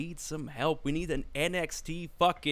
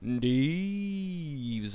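announcer intro; much slower than usual. Acted. Host hyping the guest as a ring announcer.

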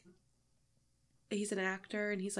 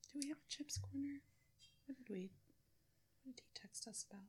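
very soft, whispered question; hushed aside; 7 semitones higher, 13 dB quieter, faster than usual. Real, not acted. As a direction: check quietly with your co-host, off the record. Off-mic aside between takes.

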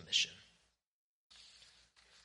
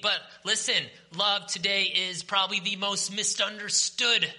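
higher and louder, punchy preacher; fired-up preaching; 6 semitones higher, 4 dB louder than usual. Real, not acted. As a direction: preach it to the back row. Preaching builds up on love.

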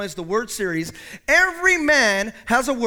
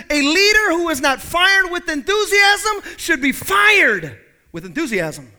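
quote; 7 semitones higher, 6 dB louder than usual. Retold or imitated. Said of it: "Somebody once said".

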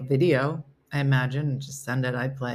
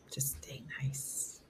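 much softer, slow whispered ending; whispered aside; 4 semitones lower, 16 dB quieter, much slower than usual. Real, not acted. Complaining about her son losing sneakers, slow whispered aside. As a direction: drop to a pointed whisper.

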